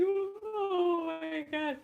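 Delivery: drawn-out, high, slow disbelief; stunned. Retold or imitated. Seeing the whole greasy room, slow stunned high gasp.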